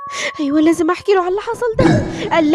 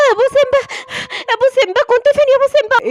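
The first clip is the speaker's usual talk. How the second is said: high, urgent questioning; agitated wife, pitch raised; anxious calling; 7 semitones higher, faster than usual. Acted. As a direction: call to your husband in a worried rush. Wife confronts returning husband.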